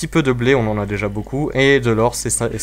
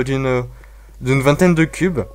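ordinary delivery

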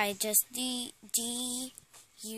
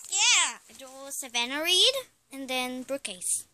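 ordinary talk, nothing marked

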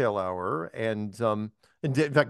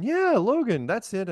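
eager Cammy; 9 semitones higher, slower than usual. Retold or imitated. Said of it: "I go, I go".

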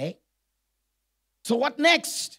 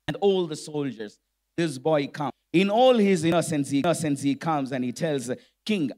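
normal talking voice

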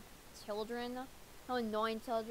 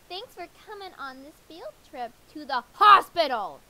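loud, bright teacher call to the class; loud teacher announcement; 2 semitones higher, 10 dB louder than usual. Acted. Teacher yelling to kids on field trip, loud. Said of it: "She's yelling on top of me".

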